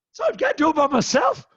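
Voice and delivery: high, loud incredulous question; indignant protest. Retold or imitated. Husband told his wife may be asleep, high incredulous protest. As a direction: voice shooting up in disbelief.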